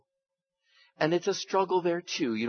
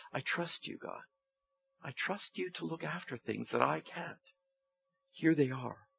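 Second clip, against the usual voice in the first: quiet, trusting prayer, fading softer; 12 dB quieter than usual. Acted. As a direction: pray it gently, letting go. Voices a prayer.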